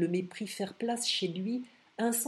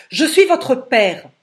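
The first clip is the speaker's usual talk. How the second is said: loud appeal; 4 semitones higher, 16 dB louder, slower than usual. A narrator's reading. Father's urgent appeal.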